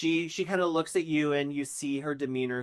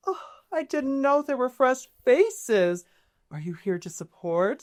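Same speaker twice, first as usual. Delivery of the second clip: higher, airy welcoming lilt; reassuring; 10 semitones higher, 3 dB louder than usual. Acted. Lady Isolde greeting fresh faces, reassuring and high. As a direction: greet the newcomers gently, like a kind host. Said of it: "Her voice ... is very reassuring".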